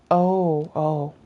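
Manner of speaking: usual speaking voice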